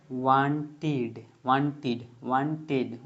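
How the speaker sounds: वांटेड with a clear id ending, drawn out slowly. Shown as a demonstration. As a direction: say वांटेड slowly as "wan-tid". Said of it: "वांटेड आएगा, ईडी आ रहा है". (वांटेड को धीरे से "वांटिड" बोलें)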